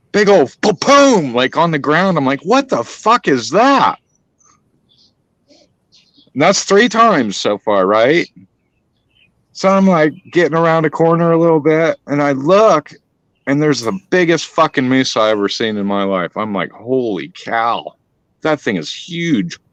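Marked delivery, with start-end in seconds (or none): 0.6-1.3 drawn-out, high booming thud; imitated big thump. Hunter retelling the repeated thump, booming imitation. 2.4-4.0 high, drawn-out incredulous question; mock-astonished, stretching the words; retold bafflement. Hunter retelling the third mystery thump, astonished. 16.7-19.6 slow, softer amazement; retold awe. Hunter retelling sighting a huge moose, awed.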